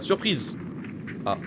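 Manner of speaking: ordinary talk, nothing marked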